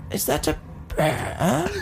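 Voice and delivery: high, slow, badly faked English; botched mock English, breaking toward laughter; failed English accent. Shown as a demonstration. Retrying the warm-up phrase, failed English accent. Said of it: "That didn't work that time".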